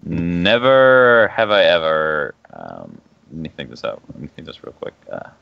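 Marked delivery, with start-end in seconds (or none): 0.0-2.3 drawn out. Acted. Drawn out game phrase.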